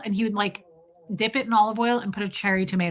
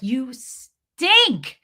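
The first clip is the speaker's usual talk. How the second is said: high, drawn-out complaint; imitating an annoyed husband, louder and stretched; mock disgusted husband; 4 semitones higher, 5 dB louder than usual. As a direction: exclaim it like a fed-up husband, leaning hard on "stink". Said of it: "Michael would get mad at me".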